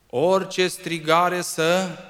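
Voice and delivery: loud, high, slow outcry; fiery sermon burst; building shout. Acted. Preacher rising.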